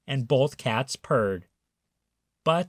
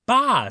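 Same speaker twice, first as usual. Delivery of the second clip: high, louder bleat; sheep baa; 10 semitones higher, 6 dB louder than usual. A narrator's reading. Reading to children, a loud high sheep bleat. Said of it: "said the sheep".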